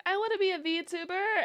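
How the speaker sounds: put-on high little-girl voice; imitating niece. Niece telling aunt her dream, high girlish voice. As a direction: play the eager niece.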